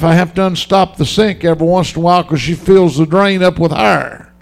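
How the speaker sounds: normal talking voice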